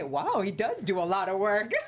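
laughing, impressed; amused surprise. Retold or imitated. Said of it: "yo dije".

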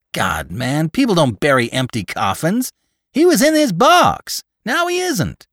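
climbing to a very high, loud protest; agitated disbelief. A narrator's reading. Gravedigger insisting people don't bury empty coffins, agitated. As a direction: argue it, pitch shooting up to a squawk.

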